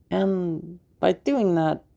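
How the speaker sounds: normal talking voice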